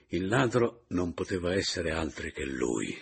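usual speaking voice

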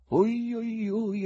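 high, drawn-out pained wail; suffering voice. A narrator's reading. Someone in the silent theatre, suffering. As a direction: moan it high as if in pain. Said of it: "esclamò qualcuno con voce sofferente".

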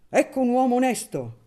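loud, high cry of approval; exclaiming. A narrator's reading. Don Nunziante praising the honest parish priest, loud exclamation. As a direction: burst out in admiration. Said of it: "esclamò Don Nunziante".

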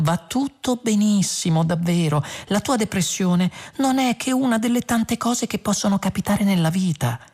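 very high singsong cheer; overdone sweet, piping lilt; fluting. A narrator's reading. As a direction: warble relentless reassurance up high. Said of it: "zufolò Trillian".

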